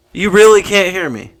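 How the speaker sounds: ordinary talk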